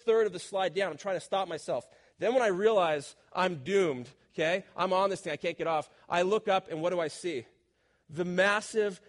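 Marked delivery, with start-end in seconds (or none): none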